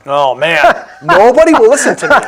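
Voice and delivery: high, loud, amused outburst with laughter; loud exclaiming. Real, not acted. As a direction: cry it out loudly, laughing at your own complaint. Laughing over his ignored church complaint.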